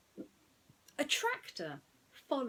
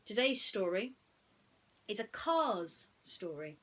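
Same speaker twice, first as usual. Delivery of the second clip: usual speaking voice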